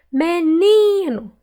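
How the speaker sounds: "Menino" slow, with a high long NI; stretched stressed syllable. Shown as a demonstration. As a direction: call out "Menino", holding NI. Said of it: "Qual que eu demorei mais falar".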